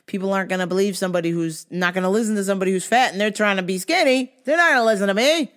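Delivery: fast, rising anxious inner voice; anxious self-talk. Retold or imitated. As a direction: rush the worried thoughts. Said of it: "always told myself".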